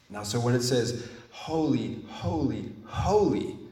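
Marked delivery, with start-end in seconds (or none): 1.3-3.6 each "holy" set apart, raised then falling; "holy, holy, holy" as three separate, weighty calls; emphatic triple repetition. Shown as a demonstration. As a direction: proclaim "holy" three times, each its own beat. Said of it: "the emphasis is all the more powerful".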